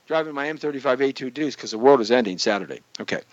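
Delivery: ordinary talk, nothing marked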